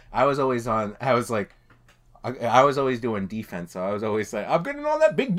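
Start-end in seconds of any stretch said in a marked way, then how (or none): 4.5-5.4 high-pitched goofy game yell; mock yelled catchphrase. Gamer retelling his defense catchphrase from a sketch, high mock yell. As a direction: call it out high, hyped up for comedy.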